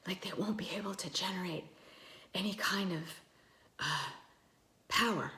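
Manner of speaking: airy whispered breathy voice. Shown as a demonstration. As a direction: let air escape on every word. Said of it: "I'll try to imitate".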